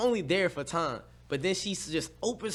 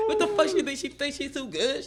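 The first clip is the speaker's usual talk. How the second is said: mocking outrage; 9 semitones higher than usual. Mocking the girl's attitude.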